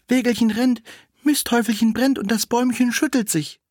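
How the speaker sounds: put-on the girl asks the shaking tree in a high childlike voice; little girl. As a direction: ask like a small child. Girl answers the spring.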